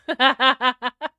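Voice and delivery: real loud, high cackle; loud laugh. Real, not acted. Streamer cracking up at her own joke, loud laugh. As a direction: burst out in a big rapid laugh.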